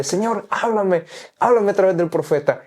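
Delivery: high, pleading cry to the Lord, slowed on háblame; retold tearful prayer. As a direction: beg the Lord through half-remembered tears. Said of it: "Y lloraba y decía". (súplica llorosa recordada, aguda)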